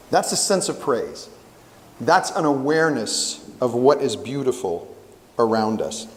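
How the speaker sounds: ordinary talk, nothing marked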